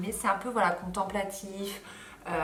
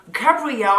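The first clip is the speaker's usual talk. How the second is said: loud, high mock American dubbing voice; exaggerated American accent; 6 semitones higher, 13 dB louder than usual. Vlogger mocks the series' French dub, exaggerated American accent. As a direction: overdo an American accent, calling it out. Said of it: "Cette espèce d'accent, euh, américain, exagéré".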